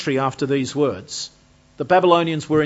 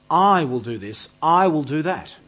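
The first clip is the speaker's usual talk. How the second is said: "I" punched louder at each start; stress on I; 4 dB louder than usual. Acted. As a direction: hit the word "I" each time, as God speaking. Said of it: "is the emphasis on God's action".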